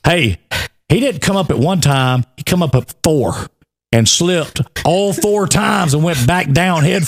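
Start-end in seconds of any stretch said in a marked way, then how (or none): none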